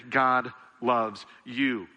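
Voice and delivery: "God loves you" drawn out word by word; very slow emphasis. Real, not acted. Preacher urging church to let it sink in, very slow emphasis. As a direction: stretch "God loves you," leaving room after each word. Repeats key line to sink in.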